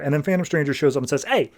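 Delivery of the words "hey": high-pitched mock command; pitched-up imitation of a rescuer stepping in; retold warning call. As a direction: call out a quick, half-hearted order. Rescuer orders ghosts away.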